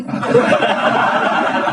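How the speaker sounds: very high breathy laugh; a shrieking burst of laughter; squeal of laughter. Real, not acted. Laughs at Umar. (tawa melengking tanpa suara)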